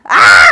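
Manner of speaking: sudden very loud yell of exasperation; frustrated scream. Real, not acted. Storyteller venting about David ignoring her, frustrated scream.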